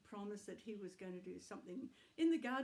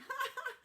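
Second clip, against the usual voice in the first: high giggle; 4 dB louder than usual. Acted. Grandma puppet laughs.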